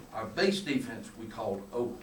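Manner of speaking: ordinary talk, nothing marked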